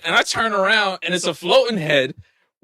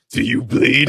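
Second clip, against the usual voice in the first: mock tough Harry; 6 semitones lower, 3 dB louder, slower than usual. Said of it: "My son Harry running up on".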